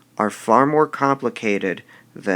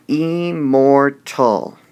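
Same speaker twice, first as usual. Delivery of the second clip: "immortal" sounded out slowly as im, mor, tal; slow, emphatic IM - MOR - TAL; syllable by syllable; 3 semitones higher, 5 dB louder, slower than usual. Shown as a demonstration. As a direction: stretch each syllable of "immortal", louder. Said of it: "this word has three syllables".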